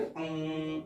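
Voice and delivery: अं said alone, soft and nasal; isolated nasal. Shown as a demonstration. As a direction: hum out the bare sound अं. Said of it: "तो अगर खाली इसको बोलना है तो क्या बोलेंगे?". (अकेली नासिक ध्वनि अं)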